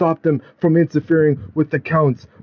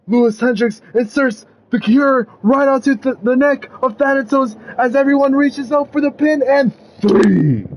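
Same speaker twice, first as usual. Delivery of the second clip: high, breathless-climax excitement building to the count; climactic play-by-play; 8 semitones higher than usual. A narrator's reading. Storyteller calls the deciding pin, rising excitement. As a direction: build high, then land the final count hard.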